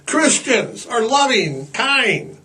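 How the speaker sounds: hammed-up pious sweetness. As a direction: lay it on sweetly like a smug believer. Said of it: "they're like".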